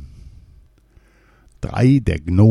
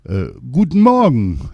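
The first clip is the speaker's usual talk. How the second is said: louder, dazed repeated greeting; repeating king; 4 semitones higher, 4 dB louder than usual. A narrator's reading. Poisoned king repeating his greeting, dazed. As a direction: say it again as if it were new. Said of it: "erwiderte der König nun abermals".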